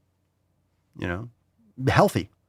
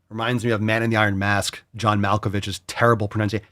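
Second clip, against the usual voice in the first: normal talking voice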